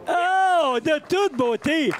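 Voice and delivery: high, loud gasp of admiration; excited exclamation. Real, not acted. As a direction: marvel out loud at the shot. Admiring a birdie live.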